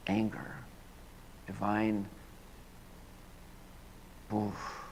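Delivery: hushed meditative prayer; guided prayer. Acted. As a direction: lead the congregation gently into stillness. Leads a prayer.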